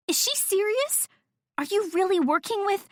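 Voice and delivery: high-pitched astonished questioning; incredulous. A narrator's reading. Friend quizzes Jen about the news, incredulous. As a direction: shoot up high in disbelief.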